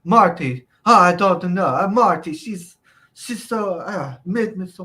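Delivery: high, slow put-on pleading; lightly hammed Iron Sheik excuse; mock Iron Sheik. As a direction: whine it like a flustered old wrestler. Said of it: "he's like".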